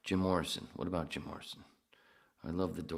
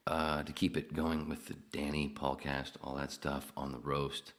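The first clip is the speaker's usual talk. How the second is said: usual speaking voice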